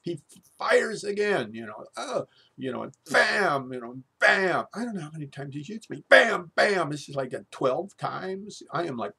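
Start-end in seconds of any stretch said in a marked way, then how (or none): 2.0-2.3 pained high oh. Retold or imitated. Shot again by biopsy gun. 6.1-6.9 loud bam. Retold or imitated. Imitating repeated gun shots.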